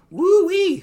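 high, loud whoop of glee; excited whoop. Acted. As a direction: whoop it like an impressed soldier. Soldier whoops at wreckage.

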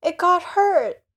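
put-on high, slow pleading boy; boy's voice. Lamy answering the spearman, high and pleading.

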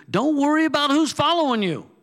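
the second line jumps way up high and loud; high-pitched mock voice. A preacher to his congregation, mocking fear of followers, high and loud. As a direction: pitch who's following you high and loud, then let it fall. Preacher contrasting two lines.

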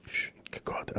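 shushing whisper. Retold or imitated. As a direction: hush him and point the way softly. Hushing the guard at exit.

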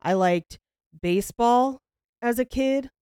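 halting, monotonous presenter impression; Chad Daybell. Host mimicking a boring speaker's slide talk, halting and dull. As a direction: plod through it with long pauses between phrases. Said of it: "but he talks like this".

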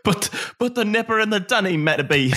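hammed-up bad Australian accent; attempted Australian accent. Quoting an Australian-accented character to the co-host, mock Australian. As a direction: have a clumsy go at sounding Australian. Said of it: "I can't do an Australian".

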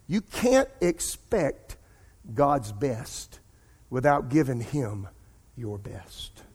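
drawn out, each phrase weighed; slow emphatic preaching. Acted. Preacher slows down to press the lesson home, drawn out. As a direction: let every word land, slowly.

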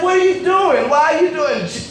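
high, indignant acted scolding; exasperated questioning. Uncle catches niece up at two a.m., exasperated. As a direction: demand an answer in disbelief.

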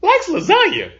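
put-on high, slow, indignant kid voice; little kid voice. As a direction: play the outraged small boy, drawn out. Dad imitating son's cartoon verdict.

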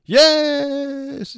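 very high, loud shout; too-loud yell. Acted. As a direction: blast the word out. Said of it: "It's too loud".

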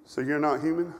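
a pastor recalling a friend's gentle rebuke; loving, kind. Retold or imitated. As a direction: ease it out kindly. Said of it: "in his loving, kind way".

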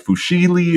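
"fusilli" said with a sh, foo-SHEE-lee; unsure mispronunciation. Real, not acted. Guessing at a pasta name, "fusilli" said foo-SHEE-lee. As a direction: say "fusilli" as if spelt fusheeli. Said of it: "however you say that".

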